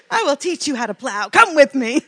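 playful rallying call. Acted. Clydesdale recruiting others to plow, mock rallying call. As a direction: call them along, half laughing. Acted invitation, breaking into laughter.

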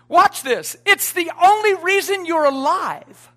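loud, driving preacher's build; full-force preaching. Acted. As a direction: preach it hard to the back row.

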